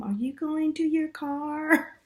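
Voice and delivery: put-on lifted, light voice of a young stranger; high young woman voice. Young lady's voice in retold story.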